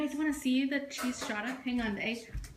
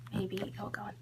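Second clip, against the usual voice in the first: muttered whisper while fiddling; whispered to herself; 5 semitones lower, 8 dB quieter than usual. Real, not acted. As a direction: say it under the breath, to yourself. Fitting camera back in tripod.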